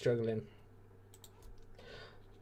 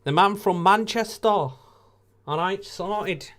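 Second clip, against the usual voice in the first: put-on loud, higher Manchester accent; 6 semitones higher, 7 dB louder, slower than usual. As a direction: put on a Mancunian voice. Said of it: "That's my Manchester accent".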